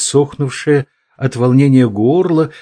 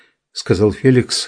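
plain reading voice

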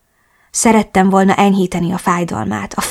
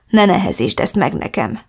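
ordinary delivery

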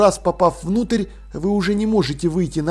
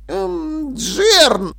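high, hesitant hum, then the name; mumbled hesitation; 10 semitones higher than usual. A narrator's reading. Dil tries to stop Jern, hesitantly. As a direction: falter before calling his name. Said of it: "промямлил Диль".

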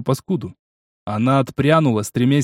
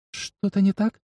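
fairy voice; 7 semitones higher, 5 dB quieter, slower than usual. A narrator's reading. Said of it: "голос феи в голове".

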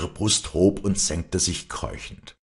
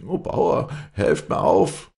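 high, feeble plea for help; pleading old farmhand; 13 semitones higher, 6 dB louder, slower than usual. Acted. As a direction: beg the master from the ground. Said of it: "bat der Knecht". (flehender alter Knecht)

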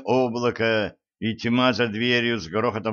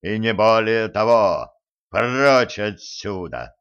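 vast deep cosmic voice. Acted. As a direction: boom down from the heavens. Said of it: "вслед им летел громовой голос".

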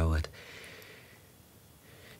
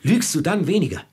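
loud and much higher, confronting; angry accusation; 10 semitones higher, 7 dB louder than usual. Acted. Traveller angrily accusing the woman of lying. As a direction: throw the accusation at her.

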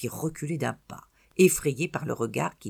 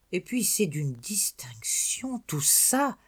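murmured to himself; 5 semitones higher, slower than usual. A narrator's reading. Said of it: "faisait-il pour lui-même".

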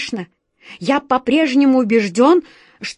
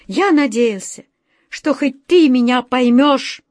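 higher, louder, bitter outcry; bitter exclamation; 5 semitones higher, 5 dB louder than usual. A narrator's reading. The director bitterly reproaching his friend, loud and high. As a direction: cry out hurt and bitter. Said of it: "с горечью воскликнул".

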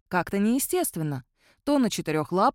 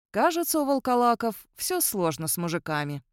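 ordinary delivery, nothing marked

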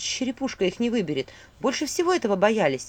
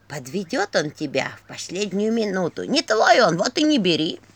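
ворчливая старуха; 4 dB louder than usual. A narrator's reading. Old Eginya scolding in memory.

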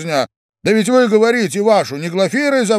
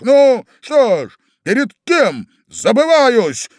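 loud, higher, slow thundering rage; 8 semitones higher, 4 dB louder than usual. Acted. Young man thunders at the gentleman in a rage. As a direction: let it thunder, fists clenched. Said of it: "загремел он, сжимая кулаки".

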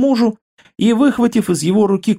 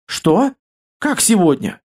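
startled, higher-pitched questions; alarmed surprise; 3 semitones higher, slower than usual. A narrator's reading. Wife learns the guest comes today, startled. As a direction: react as if caught off guard. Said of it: "всполошилась Илона".

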